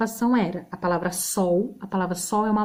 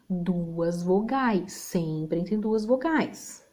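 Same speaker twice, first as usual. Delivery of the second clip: 1 slow teaching emphasis; 3 semitones lower, 3 dB quieter, much slower than usual. Shown as a demonstration. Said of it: "ensina a regra".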